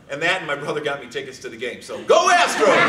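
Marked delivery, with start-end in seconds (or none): 2.0-2.8 high, loud cheer with a laugh. Acted. Fan cheering the brother's team, laughing cheer. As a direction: shout it for the crowd.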